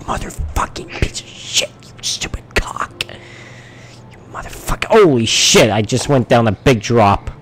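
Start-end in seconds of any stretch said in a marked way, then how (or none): 0.0-4.9 furious cursing under the breath, all whispered; hissed whispered rage; raging whisper. Retold or imitated. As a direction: whisper the insults like you're seething. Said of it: "and whispering".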